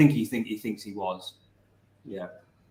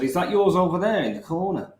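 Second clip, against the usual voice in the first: put-on Scouse accent; 6 semitones higher than usual. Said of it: "Like that with his accent".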